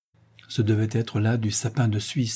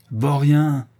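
loud, higher angry shout of Vaurien; the angry farmer yells at Ulrich, loud and high; furious cry; 4 semitones higher, 5 dB louder than usual. A narrator's reading. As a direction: bark the insult at the boy. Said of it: "s'écria-t-il".

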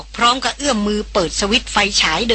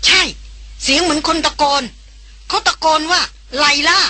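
higher, insistent woman's voice; agitated heroine, pitched up; excited heroine; 8 semitones higher than usual. A narrator's reading. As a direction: insist you really heard it.

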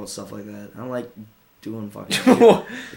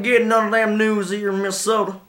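lightly put-on Minnesotan accent, higher; 8 semitones higher than usual. Joking about the local news in a light Minnesotan accent. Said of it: "more like Minnesotan accent".

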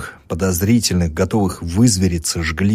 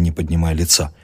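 ordinary delivery, nothing marked

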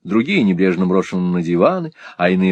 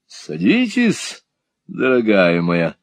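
sassy drag queen; slower than usual. Acted. Mantalini flirts with Kate.

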